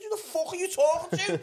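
high, loud angry challenge. Acted. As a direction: raise your voice in outraged retort. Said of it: "I started raising my voice".

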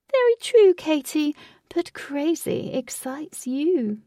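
high-pitched, theatrical relish; taunting villain. A narrator's reading. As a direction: stretch the words, toying with her. Villain Lutz toys with Katie.

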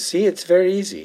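smug Nadja boasting. Retold or imitated. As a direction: show off breezily. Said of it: "Nadja turns to Jenna and she goes".

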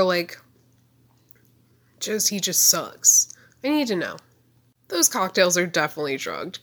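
normal talking voice

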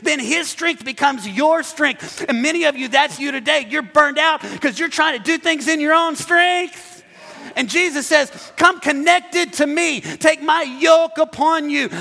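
high, loud preacher's proclamation; full-force preaching. Real, not acted.

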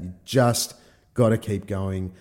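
ordinary talk

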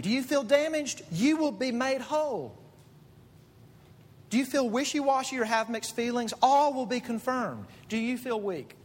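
impassioned preaching. Acted. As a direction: lift them up with each promise. Rising preaching promises.

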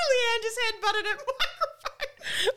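high, loud, slow through laughter; cracking up. Real, not acted. Telling listeners about the co-host's bump, cracking up. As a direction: struggle it out while laughing hard. Cracking up over headbutted microphone.

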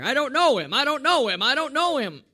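high, loud, repeated denial; acted panicky insistence; Peter. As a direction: protest it loudly, each time with the same rise and fall. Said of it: "Peter warming himself at the enemy fire".

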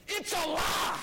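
high, loud outraged cry; retold shout. Man shaving shouts at liberal preacher on radio, loud outraged cry. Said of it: "he shouted at the top of his lungs".

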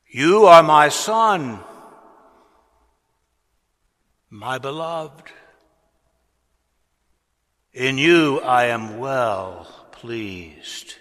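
loud, slow, proclaiming as God's voice; booming voice from heaven. Retold or imitated. Preacher voicing God's voice at Jesus' baptism, booming. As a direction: boom it out like a voice from the sky. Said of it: "a voice boomed out from heaven".

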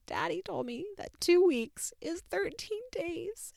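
put-on high, slow small-child voice; a hint of a weepy little boy; sad little boy. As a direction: imitate a crushed toddler reporting. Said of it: "He told me, he said".

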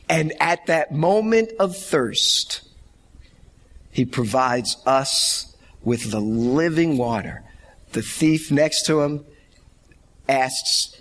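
ordinary delivery

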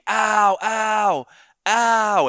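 high, loud pained cries of ow; mock screaming. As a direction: yell ow at the neighbor in mock pain. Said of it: "start screaming".

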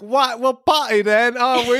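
mock British accent. Clowning for the cohost, exaggerated British accent. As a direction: overdo a silly British accent. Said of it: "[name]'s half British".

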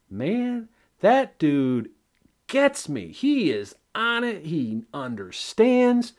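high, slow dopey voice of a fan; mock adoring voter. Mocking a Florida voter praising his congressman, high and dopey. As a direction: play a starry-eyed voter gushing. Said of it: "Florida's first congressional district that says".

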